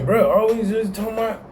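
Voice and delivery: mock the viewer who gripes about being picked on; hammed-up high griping; mock complaining viewer. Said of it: "Oh man".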